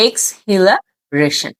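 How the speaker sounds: slow "এক্সহিলারেশন" as eks, hila, reshan; "এক্সহিলারেশন" read slowly, part by part; split into syllables. Shown as a demonstration. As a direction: sound out "এক্সহিলারেশন" in chunks with pauses between. Teacher sounds out new word.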